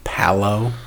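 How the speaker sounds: "palo" said for pillow, with an open a and an o ending; regional palo. Shown as a demonstration. Teasing the local accent, "palo" for pillow. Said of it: "Instead of pillow?".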